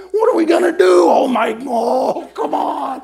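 a hammed-up childlike shout of alarm; inner little boy screaming. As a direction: scream it like a frightened little kid. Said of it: "my little boy's just in there screaming".

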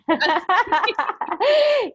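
high, loud burst of laughter at a joke; real laugh. Real, not acted. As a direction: crack up at the quip. Laughs at social security joke.

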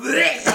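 high loud gagging bleh; disgusted bleh. Retold or imitated. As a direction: retch in mock disgust. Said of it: "she went".

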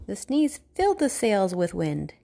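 usual speaking voice

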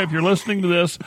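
usual speaking voice

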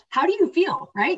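rising hype in the voice; excited. Real, not acted. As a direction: fire the listener up with the question. Pumped-up question to listener.

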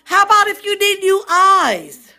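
preach. Real, not acted. Preacher urging the congregation to receive it, preaching at full force. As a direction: call out the promises with force.

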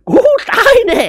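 high, loud terrified wail; an old lady crying out she's done for; faint with fright. Acted. Old lady wailing in fright at the box. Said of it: "มือเท้าอ่อนเหมือนกับจะเป็นลม".

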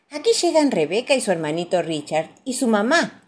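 plain reading voice

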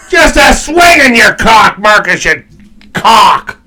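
loud, high, laughing taunt; mock heckling shout. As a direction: playfully yell it, drawing out the words. Mock-heckling absent Marcus.

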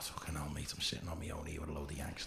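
near-whispered grumble to himself; hushed private aside; hushed inner thought. Retold or imitated. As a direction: say it under your breath, very low. Muttered thought to himself, alone.

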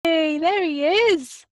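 slow, bright hello called out loud and high; excited greeting. Real, not acted. Host greets her friend as he joins the call, excited and loud. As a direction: welcome your friend onto the call with delight.

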